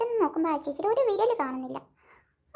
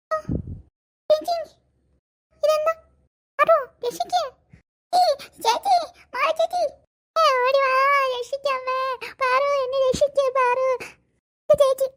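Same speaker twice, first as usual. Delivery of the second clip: panicked cries for help; 7 semitones higher than usual. Acted. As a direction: scream for rescue in panic. Sister carried off, cries help.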